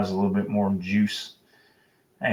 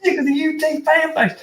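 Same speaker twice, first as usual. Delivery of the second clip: angry caller screaming at the radio host, in a much higher mock scream. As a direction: impersonate a caller losing it on air. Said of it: "dude is screaming at the top of his lungs".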